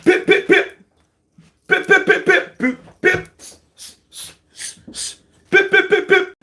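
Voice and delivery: high, loud clipped pip noises; jab sounds. Retold or imitated. Showing how Fury jabbed, high imitated punch noises. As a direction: pop out each jab like a punch landing. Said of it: "That's what he did".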